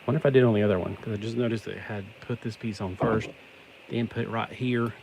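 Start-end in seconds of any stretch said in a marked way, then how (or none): none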